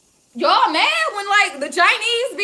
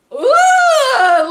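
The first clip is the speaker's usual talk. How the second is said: loud laughing whoop; 5 semitones higher, 6 dB louder than usual. Real, not acted. Overwhelmed by joy at community.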